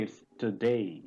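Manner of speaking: today with a clear tə and a pure long A ending; correct today. Shown as a demonstration. Teacher correcting the class's today. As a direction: model today the right way, tə-day. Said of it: "this is not today".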